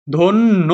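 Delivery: ধন্য split as "dhon-no"; doubled n. Shown as a demonstration. Teacher sounding out syllables, ধন্য as "dhon-no". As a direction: linger on the n of ধন্য, ending in o. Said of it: "ধয়কারে ধো".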